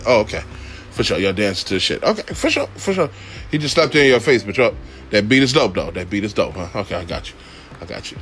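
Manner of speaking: low, dry sarcastic okay; sarcastic mock agreement. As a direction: play along with fake approval, low. Mocking passengers dancing to insults.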